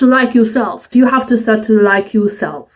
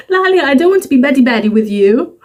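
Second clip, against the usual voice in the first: higher, fast American friend voice; imitating American friend; 5 semitones higher, faster than usual. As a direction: mimic her American English quickly. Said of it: "I had an American friend who said".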